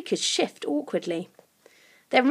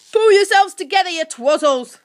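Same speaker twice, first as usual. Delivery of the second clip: loud, high creature scolding; angry goblin ordering them about; hissed rebuke; 8 semitones higher, 10 dB louder than usual. A narrator's reading. Creature scolds his laughing gang, hissing angrily. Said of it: "hissed Grunt".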